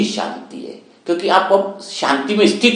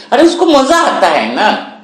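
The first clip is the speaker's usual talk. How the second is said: delighted; 9 semitones higher, 4 dB louder than usual. Acted. Joyful exclamation about cuckoo.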